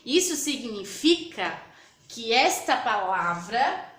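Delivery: ordinary talk, nothing marked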